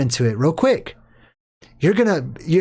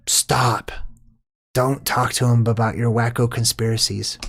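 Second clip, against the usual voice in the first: a hint of a curt, lower mom; dismissive mom; 4 semitones lower than usual. Mom shutting down her husband, lightly voiced and curt. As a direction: brush him off coldly. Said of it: "the mom looks at him like".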